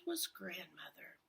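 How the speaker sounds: hushed whisper; whispered reveal. A narrator's reading. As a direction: breathe the reveal softly. Reveal of grandmother.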